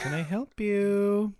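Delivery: high, drawn-out sales greeting; perky shop greeter. Retold or imitated. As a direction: imitate an overeager shop assistant, slowly.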